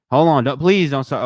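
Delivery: loud sharp hold on; loud playful warning; calling out. Real, not acted. Calling out a player.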